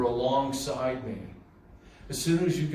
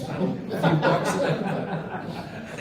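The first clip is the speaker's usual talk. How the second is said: laughing; 7 semitones higher, 6 dB louder, slower than usual. Real, not acted. Laughing at brother's gold bar belief.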